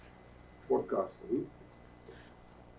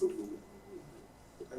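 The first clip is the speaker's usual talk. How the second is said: quiet refusal; 6 semitones lower, 13 dB quieter than usual. Retold or imitated. Refusing the begging man.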